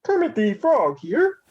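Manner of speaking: high froggy puppet voice; Kermit the Frog voice. Pretend. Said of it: "do yours".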